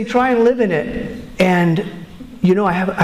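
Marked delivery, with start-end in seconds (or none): 0.0-1.2 put-on amateur Irish accent, pitched higher. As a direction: imitate an Irish friend's lilt, not quite convincingly. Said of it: "with his Irish accent".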